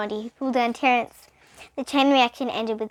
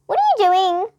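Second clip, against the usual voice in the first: higher, louder indignant demand; 9 semitones higher, 7 dB louder than usual. A narrator's reading. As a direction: challenge him sharply. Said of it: "she demanded".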